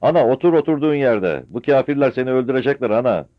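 loud high urgent yell; anxious shout. Acted. Son shouts at mother to stay down, urgent. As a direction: order her to stay put.

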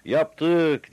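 very loud, high, drawn-out yell; crowd shouting up. A narrator's reading. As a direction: holler it up to the roof. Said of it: "diye bağırdılar".

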